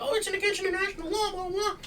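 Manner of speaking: high, mocking sing-song of the critics; mocking whiny voice. Host mocking critics of the Maduro arrest in a high whiny voice. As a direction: mimic the critics in a silly high voice. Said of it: "all these people being like".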